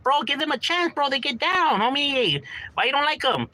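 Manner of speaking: high-pitched imploring protest; pleading. Retold or imitated. Asking homies to give the rappers a chance, pleading. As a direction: act out pleading on someone's behalf, pitched well up.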